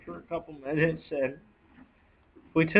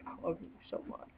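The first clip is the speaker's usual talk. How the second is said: whisper; 17 dB quieter than usual. Real, not acted. Grieving owner speaks of dead horse.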